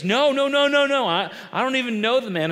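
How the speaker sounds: high, loud frantic no's; panicked denial. Retold or imitated. Peter panics, denies Jesus.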